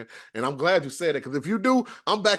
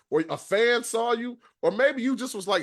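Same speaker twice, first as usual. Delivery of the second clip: ordinary delivery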